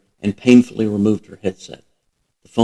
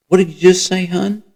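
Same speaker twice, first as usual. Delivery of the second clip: startled; 10 semitones higher, 4 dB louder than usual. A narrator's reading. Sudden realization, startled question.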